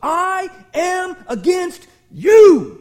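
loud, slow, high roar right in the face; furious shout. Acted. God shouting in the sinner's face, slow and loud. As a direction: thunder it, slow and confrontational. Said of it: "God goes right up to your face and goes".

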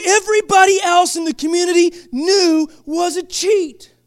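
rising, shouting sermon, high and loud; hot, high and loud pulpit preaching; preaching at full force. Acted. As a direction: drive the crowd with a fiery preacher's cry.

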